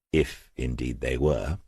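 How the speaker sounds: usual speaking voice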